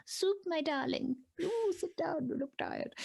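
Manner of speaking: put-on dote on them like a sweet old checkpoint granny; high-pitched, slow grandmotherly fussing; kindly granny. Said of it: "They'll be in there going".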